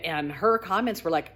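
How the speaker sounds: ordinary talk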